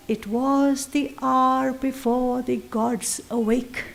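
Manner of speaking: slow, soft, reverent reading of the verse; poem recital. Acted. Teacher reciting the opening line of Savitri, slow and soft. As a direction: read it like an opening invocation. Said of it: "The opening line is".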